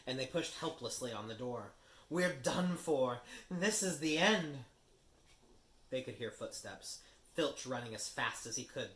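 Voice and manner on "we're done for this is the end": slow, louder, high wail of doom; despairing moan. Acted. Ron at the locked door, slow despairing moan. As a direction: give up hope. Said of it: "Ron moaned".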